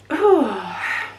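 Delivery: a heavy shaky sigh before she apologises; tearful sigh. Real, not acted. Mother on YouTube breaking off in a tearful sigh. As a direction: let out a real tearful sigh.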